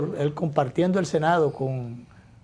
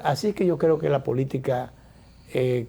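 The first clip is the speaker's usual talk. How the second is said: usual speaking voice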